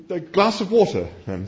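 high, timid request; meek retreat. A narrator's reading. Arthur meekly backs down.

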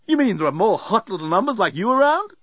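high, eager, cheeky bellbot; excited robot. A narrator's reading. Bellbot asks passenger about her colleagues, excited. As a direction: ask with high-pitched excitement.

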